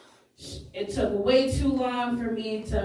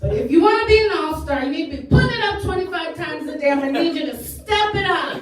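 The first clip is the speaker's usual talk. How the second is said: loud, quick, raised coach-style urging; mock pep talk; 4 semitones higher, 5 dB louder, faster than usual. Sex ed teacher pushes would-be all-star to step it up, rousing. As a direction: drive them on like a coach, with comic force.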